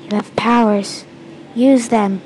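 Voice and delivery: ordinary delivery, nothing marked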